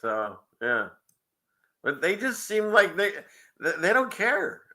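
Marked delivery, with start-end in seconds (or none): none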